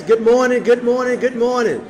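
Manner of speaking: high, loud good mornings, again and again; repeated loud greeting. Real, not acted. Speaker calling out repeatedly to a silent crowd, loud and high. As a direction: keep calling it until the crowd answers. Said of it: "I said good morning like three or four".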